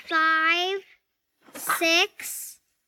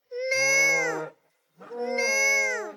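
higher little calf mooing; 8 semitones higher than usual. Acted. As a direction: moo like a young calf. Calf answers in story.

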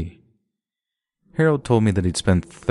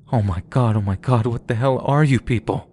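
higher, louder panicked scream; 5 semitones higher, 3 dB louder than usual. A narrator's reading. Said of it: "I then heard Harold screaming".

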